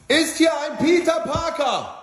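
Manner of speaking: high, slow villain voice; a menacing cartoon villain, drawn out; Green Goblin voice. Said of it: "der grüne Kobold".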